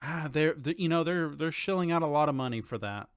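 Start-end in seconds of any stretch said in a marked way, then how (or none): none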